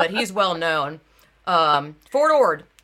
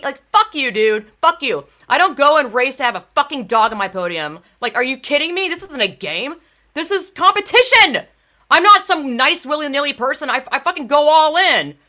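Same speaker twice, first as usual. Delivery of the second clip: loud, higher, furious outburst; real fury; 5 semitones higher, 6 dB louder than usual. Real, not acted. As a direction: spit it at him, all in. Furious rant at the organizer.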